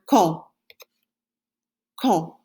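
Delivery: quand said kahn, nasal, d silent; French quand. Shown as a demonstration. Teacher repeats quand for the class, nasal French. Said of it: "it's pronounced, say one more time".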